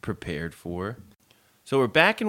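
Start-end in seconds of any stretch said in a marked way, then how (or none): 0.0-1.0 taken aback. Retold or imitated. Acts out a taken-aback date.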